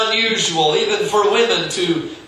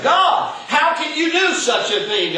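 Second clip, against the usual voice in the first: pleading cry; 7 semitones higher than usual. Acted. Abraham pleads with God.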